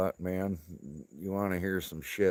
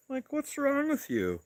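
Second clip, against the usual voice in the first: mock outraged question; 3 dB louder than usual. Acting out yelling at driver.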